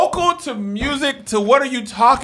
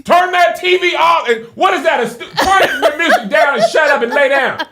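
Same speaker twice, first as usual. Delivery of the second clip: yelling parent; 4 semitones higher than usual. Retold or imitated. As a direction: shout at the kids to get to bed. Parent yelling at kids upstairs.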